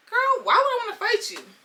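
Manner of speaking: high, baffled protest; mock-incredulous with leaping pitch; incredulous reply. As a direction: throw it back in total disbelief. Acted reply to challenge to fight.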